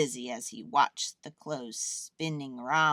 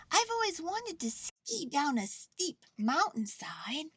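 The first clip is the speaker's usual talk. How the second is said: high, gleeful little bear; high voice with a smile in it; smiling delight; 11 semitones higher than usual. A narrator's reading. Bear sliding as if skiing, smiling delight. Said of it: "he said with a smile".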